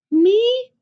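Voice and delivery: high, loud, delighted chick's squeak; a small hen thrilled to be asked; surprised little chicken. Acted. Chicken surprised papa wants her story, high squeak. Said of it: "Said the little red chicken".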